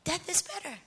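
hushed, as if thinking it; inner thought. Retold or imitated. Daughter praying aloud while secretly thinking this, hushed. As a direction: keep it soft, to yourself. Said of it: "But in my mind".

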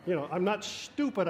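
ordinary talk

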